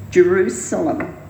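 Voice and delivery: normal talking voice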